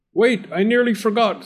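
high-pitched flustered exclamation; sudden remembering. Acted. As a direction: blurt it out as you remember. Suddenly remembers cake, runs off.

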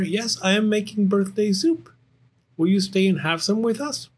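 high, eager bear cub; hospitable Little Bear. Acted. Little Bear offering Duck some soup, high and eager. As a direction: play a young bear proudly inviting a guest.

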